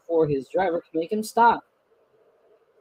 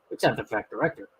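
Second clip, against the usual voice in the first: ordinary delivery, nothing marked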